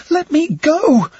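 high, loud, drawn-out shout; desperate high-pitched yell; shouting to be released. A narrator's reading. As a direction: struggle against a grip, shouting. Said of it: "she shouted".

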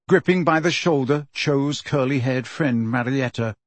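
ordinary delivery, nothing marked

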